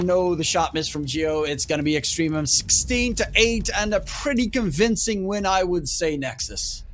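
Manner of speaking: fast, rising live play-by-play; esports caster. Acted. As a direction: call the action as it happens.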